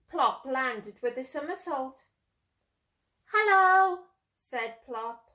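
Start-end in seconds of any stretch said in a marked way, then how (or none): 3.3-4.0 bright owlet greeting. Acted. Plop greets the boy brightly.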